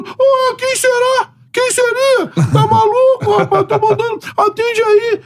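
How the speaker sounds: very high mock coach voice; mock him up high, half scolding; high indignant coach impression; Jair scolding.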